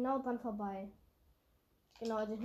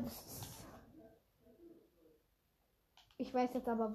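ordinary delivery

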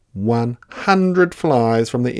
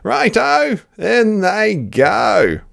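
high, loud, cheeky Dingo; a greedy dog egging the others on; 12 semitones higher, 6 dB louder, slower than usual. Acted. Dingo cheers Platypus tipping mud in.

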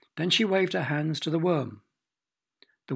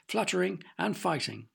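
ordinary delivery

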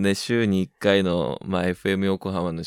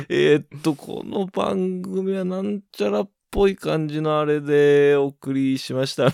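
high, slowing improvised sponsor read; bluffing announcer; 6 semitones higher than usual. Retold or imitated. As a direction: fake your way through the credit.

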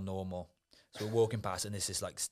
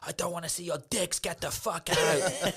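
angry manager; 9 semitones higher, 4 dB louder than usual. Retold or imitated. Bar manager throwing the naked lads out, mock angry yell. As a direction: bark like a fed-up bar manager. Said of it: "he was like".

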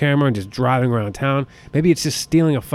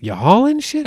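"hauling" jumps way up high and louder; squeaky incredulous repeat; 12 semitones higher, 5 dB louder than usual. Retold or imitated. Mocking the truck driver, disbelieving high squeak. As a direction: pop "You hauling shit?" up into a squeak. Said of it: "And I'm like".

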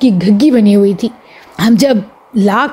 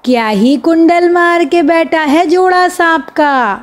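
slow, higher showman's spiel; a boy playing a hawker-style magician, slow and higher; street magician patter; 4 semitones higher, slower than usual. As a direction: drum up the crowd like a roadside conjuror. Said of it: "بچوں سے بولے".

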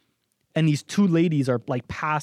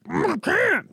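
muffled words round a mouthful; speaking through a full mouth. Retold or imitated. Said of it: "my mouth was full".

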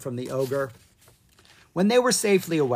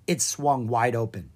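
ordinary delivery

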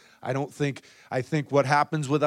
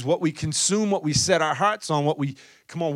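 ordinary delivery

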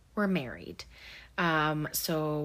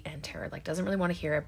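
normal talking voice

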